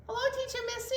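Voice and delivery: high squeaky puppet voice; Tiger puppet voice. Acted. Tiger puppet greets Teacher Missy in a high voice. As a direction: play a little tiger puppet. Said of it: "Tiger would like to join us".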